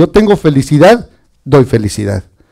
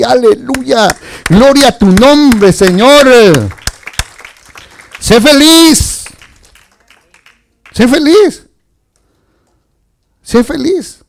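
high, slow, drawn-out exhortation; fervent preaching; 7 semitones higher, slower than usual. Real, not acted. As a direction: lift it up and stretch each word to the church. (predicación fervorosa, aguda y lenta)

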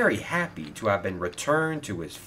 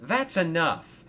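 higher, slow scolding; mild shout; 8 semitones higher, 3 dB louder, slower than usual. Acted. Thomas tells his coach that's enough, mildly shouting. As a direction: put a stop to it. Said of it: "shouted Thomas".

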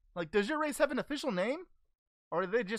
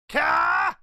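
battle-cry attack shout; 4 semitones higher, 10 dB louder than usual. Acted. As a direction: bellow it syllable by syllable, building to a blast. Goku fires his signature attack.

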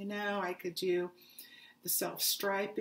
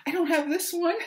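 excited; 9 semitones higher, 9 dB louder, faster than usual. Real, not acted. Gushing over new yarn.

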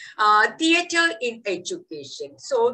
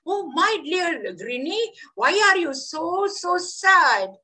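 higher, sympathetic voice of the wind; tender wind; 8 semitones higher than usual. Acted. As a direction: coo over the sad little leaf. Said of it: "the wind and asked".

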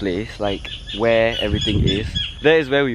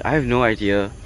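normal talking voice

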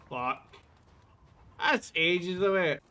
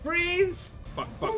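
normal talking voice

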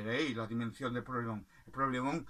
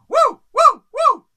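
very high, very loud barking; dog barking; 21 dB louder, much slower than usual. Acted. Dog barking again at the rooster, very loud and high. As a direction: bark with all the force you have. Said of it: "le dijo".

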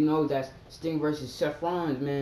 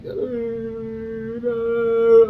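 high, loud groaning uh no; dismayed no; 11 semitones higher, 11 dB louder than usual. Retold or imitated. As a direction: heartbroken protest. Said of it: "I was like".

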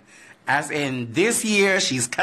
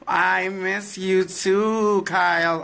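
higher, very slow cooed reply; sweet cooing; 6 semitones higher, much slower than usual. Real, not acted. Answering fan Kyle who misses her, sweet coo.

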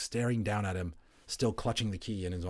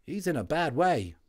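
much higher, louder chirpy soldier voice; a WWI orderly waking up chatty; friendly Cockney Tommy, loud and high; cheery Cockney medic; 7 semitones higher, 4 dB louder than usual. Acted. Tommy examines Boone.

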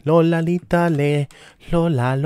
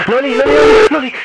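high, loud, hammed-up whimpering of Loli; fake-cry the name in mock despair; mock wail; 8 semitones higher, 9 dB louder, much faster than usual. Gamer hams up a scared wail at the monster, loud and high.

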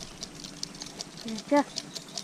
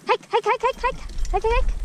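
high, loud dog command calls; urging the dog forward; calling out; 9 semitones higher, 3 dB louder than usual. Real, not acted.

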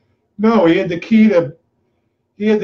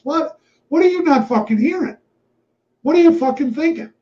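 pitched way up, exasperated disbelief; exasperated outburst; 10 semitones higher than usual. Retold or imitated. To his boss over giving away the business, high exasperated disbelief. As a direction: demand to know what he's thinking.

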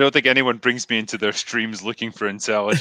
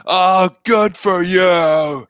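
loud, drawn-out sarcastic shout, pitched up; mock rant; 8 semitones higher, 7 dB louder, much slower than usual. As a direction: parody an actor's furious outburst, stretching each word. Imitating the rant just played.

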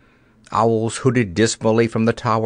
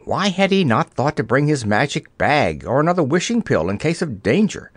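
usual speaking voice

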